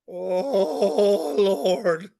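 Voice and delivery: a drawn-out put-upon sigh of a reply; weary church lady. Retold or imitated. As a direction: moan it like every bone aches. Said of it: "Here's the answer you get".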